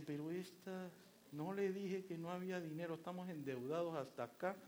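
quiet exasperated husband; under-the-breath grumble. Retold or imitated. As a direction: grumble to himself about the spending. Husband mutters about his wife.

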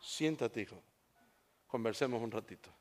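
low, soft, unhurried reassurance; calm father. Acted. Father asking his son to stay and talk, calm and gentle. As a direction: speak gently to settle a child.

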